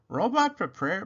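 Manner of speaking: ordinary delivery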